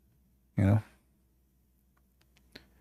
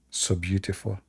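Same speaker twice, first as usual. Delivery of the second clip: much softer mock admiration; sarcastic hush; 6 semitones lower, 8 dB quieter than usual. Real, not acted. A streamer mocking a grave drawing, soft sarcastic awe. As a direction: breathe it like false awe.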